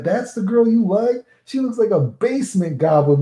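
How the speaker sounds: high-pitched incredulous ribbing; making fun. Retold or imitated. Friend teasing him about the girl he likes, mocking. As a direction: imitate a friend's mocking disbelief. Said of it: "he was making fun of me".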